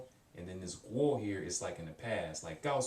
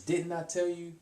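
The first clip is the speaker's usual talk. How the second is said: high, louder, mock-indignant reproach; mildly upset; 9 semitones higher, 5 dB louder than usual. Shown as a demonstration. Teacher demoing ma as a playful scolding to the student. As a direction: scold lightly, half laughing. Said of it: "someone is a little bit upset about something".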